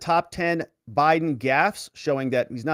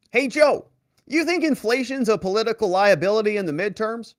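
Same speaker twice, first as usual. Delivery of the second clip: higher, eager reporter calling out; imitating a reporter; 7 semitones higher than usual. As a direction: pitch up and call out a question to the president. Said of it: "Peter Doocy said the following".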